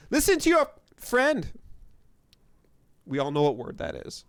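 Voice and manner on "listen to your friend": put-on high, loud kid voice; high-pitched taunting boy; bratty boy. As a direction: jeer it in a squealing child's pitch. Plays the shouting boy.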